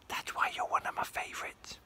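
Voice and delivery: secret whispered aside; whispering a secret to Nori. Real, not acted. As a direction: lean in and whisper it.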